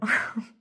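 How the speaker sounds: short, loud burst of laughter; embarrassed laugh. Real, not acted. Laughs at own embarrassing story.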